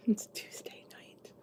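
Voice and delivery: whispered aside; hushed sleepy aside. Real, not acted. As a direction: breathe it softly.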